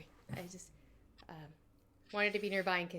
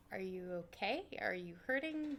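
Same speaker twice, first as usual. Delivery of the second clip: soft whisper; 8 dB quieter than usual. Acted. Paru checking on Verlaine.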